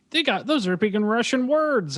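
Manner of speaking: mock alarm.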